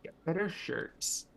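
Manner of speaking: slow, quiet mock lecture; mock stern advice. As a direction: deliver it like a stern PSA. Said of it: "echoing mock advice".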